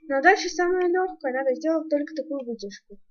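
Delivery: ordinary delivery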